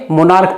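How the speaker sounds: "मोनार्क" closed on k, not ch; a hard k at the end of "मोनार्क"; correct pronunciation. Shown as a demonstration. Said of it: "इसको क्या पढ़ेंगे". ("मोनार्क" के अंत में क की आवाज़)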